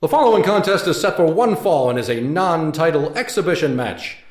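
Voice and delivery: loud, high ring announcer; grand entrance announcement. Acted. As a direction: introduce the fighter to the arena.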